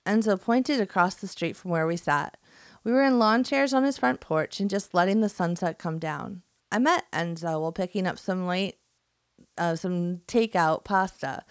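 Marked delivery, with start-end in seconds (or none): none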